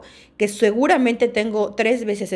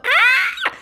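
sudden high scream of panic; panicked scream; 6 dB louder than usual. Retold or imitated. Speaker acting out a panic attack on a plane, screams. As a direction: let out a short terrified scream.

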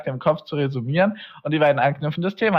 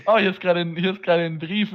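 ordinary talk

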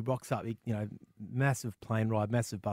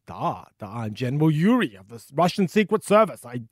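hammed-up Russian officer, getting louder and higher; Russian accent; 6 semitones higher, 11 dB louder than usual. Old Secret Service man introducing himself to a visitor, Russian accent. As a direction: play a proud Russian general with a thick accent. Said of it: "It's not a terrible accent".

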